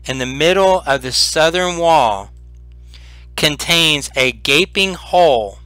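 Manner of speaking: slow, measured read-aloud description; game master's scene setting. A narrator's reading. Game master describing the ruined chamber to players, slow and measured. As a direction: paint the room for the players slowly.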